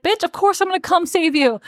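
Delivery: high-pitched retold exasperation; mock indignant. Podcaster voicing Elias answering Dora's plea, mock indignant. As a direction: imitate an affronted, of-course reply.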